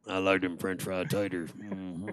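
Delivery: low, gruff Sling Blade drawl. Retold or imitated. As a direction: mumble it like Sling Blade, deep and slow. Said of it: "Go ahead, Sling Blade".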